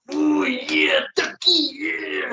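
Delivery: high, slow, shouted raspy promo; mock a wannabe Macho Man; Randy Savage impression. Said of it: "trying to do a Randy Savage impression".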